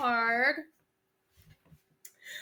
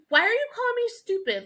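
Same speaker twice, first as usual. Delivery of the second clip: high, quicker protest; mock offended; 9 semitones higher, 4 dB louder, faster than usual. Wife retells protesting to husband, mock offended. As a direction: act wounded and indignant, playfully.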